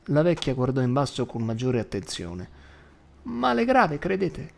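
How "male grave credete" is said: put-on high old woman in alarm; startled granny, pitched up; a touch of an alarmed old woman; alarmed grandma.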